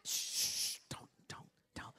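unvoiced shush and whispered don't; mock whispered hush. Preacher mocking hushed believers, whispered shushing. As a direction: shush them in a frantic whisper.

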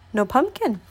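usual speaking voice

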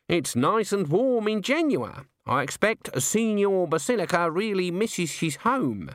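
put-on slow, high, sweetly innocent old woman; high-pitched polite small talk with an edge; Granny feigning innocence. A narrator's reading. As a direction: draw it out, all innocence.

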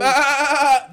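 loud, high goat bleating. Pretend. Host hailing his tennis hero as the goat, loud bleating.

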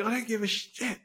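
high, strained shout held down in volume; mock shouting. Harrison Ford at the wife mid-gunfight, mock shout. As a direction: imitate a frantic yell without full loudness. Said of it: "he's shouting, going".

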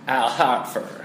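Al Horford drawled as "Al Hartford", with an "ar" vowel; imitating a Southern Horford. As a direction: mimic a Georgia-style "Al Hartford". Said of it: "your impersonation of someone from down, down Georgia way".

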